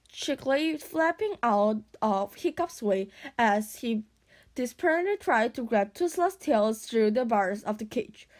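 plain reading voice

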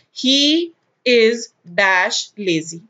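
very slow, word-by-word example reading; spaced-out, deliberate dictation. Shown as a demonstration. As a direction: read it out slowly for students to follow. Dictating an example sentence to students.

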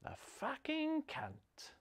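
whispered curse under the breath; muttered whisper. Retold or imitated. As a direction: hiss it slowly under your breath. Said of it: "mutter under her breath".

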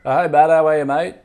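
put-on laying on a thick Bogan accent; tough Bogan accent. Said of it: "I'm gonna put on a tough Bogan accent".

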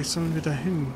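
normal talking voice

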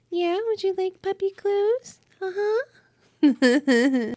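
high, soft cooing at a puppy. Real, not acted. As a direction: coo over a tiny dog. Baby talk to tiny puppy.

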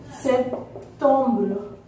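"September" very slow, pause after "Sep"; slow teaching split of "September", French ending; syllable by syllable. Shown as a demonstration. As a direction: break "September" into "Sep... tombre". Teacher splits the word for learners.

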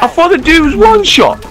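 exasperated disbelief. Real, not acted. Misjudged enemy in game, exasperated.

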